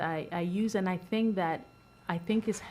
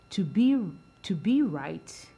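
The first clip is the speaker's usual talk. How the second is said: slow "to be right", with "be" leaned on; stress on be; 4 dB louder, slower than usual. Shown as a demonstration. Board member shows the stress on "be". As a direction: drag out "be" and press it. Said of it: "the emphasis is be, not do".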